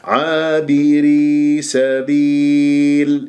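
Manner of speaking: very slow chanted tajweed; full vowels recitation. Shown as a demonstration. Teacher models the verse ending, slow recitation. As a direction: complete each i vowel, drawing it out. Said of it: "وتم الحركات".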